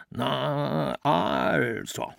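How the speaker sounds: a lightly voiced stern father, drawn-out A; father's voice. A narrator's reading. A son reading the letter in his father's voice, mildly stern. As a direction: read it like his father would, stretching the A. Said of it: "las mit der Stimme seines Vaters".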